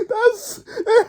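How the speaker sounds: high, breathless words through helpless laughter; can barely speak for laughing; laughing hard. Real, not acted. Kid retelling a funny video to fans, cracking up.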